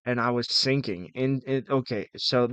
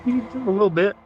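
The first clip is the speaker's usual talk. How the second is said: laughing; 8 semitones higher, 4 dB louder than usual. Real, not acted. Laughing at himself.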